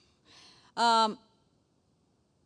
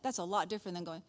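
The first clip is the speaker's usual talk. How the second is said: ordinary talk, nothing marked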